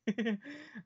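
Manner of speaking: high tipsy giggle; drunken giggle. Acted. Woman caught as she falls, giggling drunkenly. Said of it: "drunken giggles thing".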